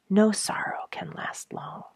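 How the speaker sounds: ordinary delivery, nothing marked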